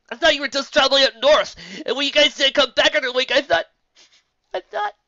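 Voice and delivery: words breaking into tears; crying. Acted.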